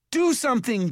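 loud, high, urgent cry; panicked call. A narrator's reading. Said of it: "Jared called, panicked".

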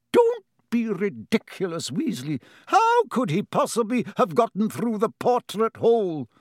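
high, stern disbelief; exasperated scolding. A narrator's reading. Professor McGonagall dismissing the boy's story, exasperated.

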